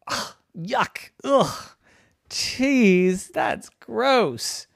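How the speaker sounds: high, squeaky groans of yuck; disgusted kitty. Acted. Kitty friend rejects the cheese, high and disgusted. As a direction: turn your nose up at it.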